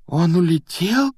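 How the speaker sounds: slow, high, dismayed question; faintly crestfallen, drawn out; weakening voice. A narrator's reading. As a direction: ask it in mild disbelief. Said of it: "произнёс Гарри слабеющим голосом".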